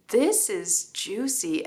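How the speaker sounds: usual speaking voice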